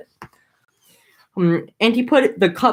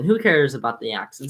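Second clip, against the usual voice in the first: usual speaking voice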